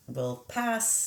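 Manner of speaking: usual speaking voice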